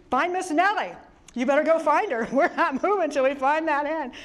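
half-amused imitation of a commanding general; mock general's command. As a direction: play the general halting the army for a hen. General orders the search stopped.

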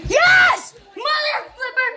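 high, excited victory yell; triumphant shout. Real, not acted. Celebrates a kill.

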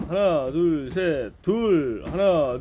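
ordinary talk

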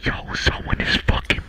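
hushed unvoiced whisper, barely audible. Real, not acted. Streamer hearing breathing whispers to chat, hushed. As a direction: whisper so the thing breathing can't hear. Hears breathing in horror game.

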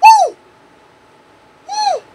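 very high whoops; pure celebration; wild whoop. Real, not acted. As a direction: whoop like at a goal. Celebrating a pull.

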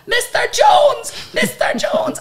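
high, loud drawn-out shout; calling after a star. Acted. Singer chasing her idol up the corridor, high urgent shout. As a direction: yell his name down a corridor.

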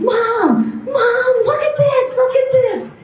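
high, thrilled call down the hallway; retelling a son's overjoyed shout; excited calling out. Retold or imitated. Son calls to mom about his healed arm, high and thrilled.

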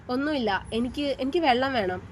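ordinary delivery, nothing marked

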